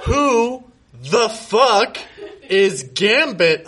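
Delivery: slow, high, drawn-out demand. Acted. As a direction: stretch each word out, insisting. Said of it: "I demanded".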